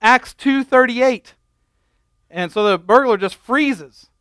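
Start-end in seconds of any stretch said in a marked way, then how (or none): 0.0-1.3 startled shout, high and loud; yelling out. Retold or imitated. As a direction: shout it like a scared old lady. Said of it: "just yells out".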